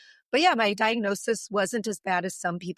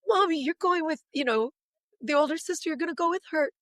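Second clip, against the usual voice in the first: put-on happy child voice; 8 semitones higher than usual. Daughter's happy voice.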